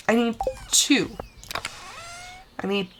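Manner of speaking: ordinary talk, nothing marked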